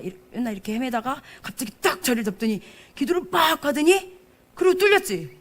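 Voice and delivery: usual speaking voice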